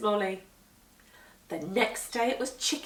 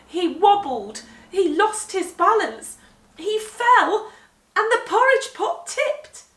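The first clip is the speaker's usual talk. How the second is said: louder and higher as the pot falls; rising excitement; 9 semitones higher, 8 dB louder than usual. A narrator's reading. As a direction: build the drama of the crash. Narrating the pot's fall.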